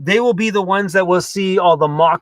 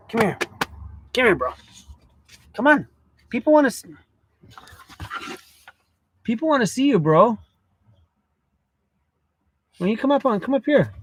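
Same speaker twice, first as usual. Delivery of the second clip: higher, sing-song voice for a pet; coaxing the dog; 5 semitones higher than usual. Real, not acted. Calling the dog Cruz up onto the bed, higher. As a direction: coax a shy dog up to you.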